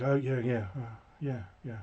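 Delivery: soft, offhand yeahs trailing away; quiet mumble. Retold or imitated. Rock star quietly answering an excited fan, mumbled yeahs. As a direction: mumble it, barely there, imitating a shy star. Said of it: "And he's very quiet".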